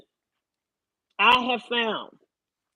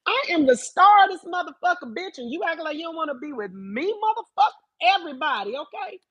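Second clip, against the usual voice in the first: sassy self-hype; 7 semitones higher than usual. Retold or imitated. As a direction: hype yourself up like a star. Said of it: "And I'm like".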